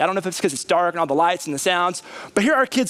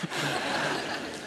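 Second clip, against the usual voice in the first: soft breathy laugh; 2 semitones lower, 10 dB quieter than usual. Real, not acted. Laughs at own joke.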